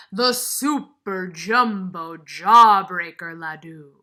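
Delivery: loud, slow and grand; grand announcement. Acted. Teacher unveiling the giant sweet to the kids, slow grand announcement. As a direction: announce it like a fabulous prize.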